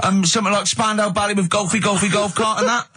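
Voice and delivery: eager caller. Retold or imitated. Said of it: "wanted to get through and go".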